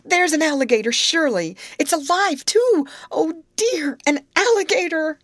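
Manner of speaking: high, loud panicked outcry, acted; screaming. A narrator's reading. Grace warns her friends of a live alligator, screaming. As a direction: sustain a high-pitched cry of alarm. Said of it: "screamed Grace".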